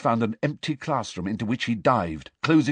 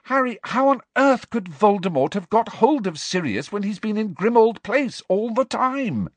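high, agitated protest; desperate; 12 semitones higher, 4 dB louder than usual. A narrator's reading. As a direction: argue desperately, pitched high. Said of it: "said Hermione desperately".

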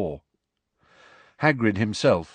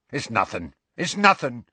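hasty, dismissive reassurance; 2 semitones higher than usual. A narrator's reading. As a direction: brush it off quickly, more insistent on the repeat. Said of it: "said Hagrid hastily".

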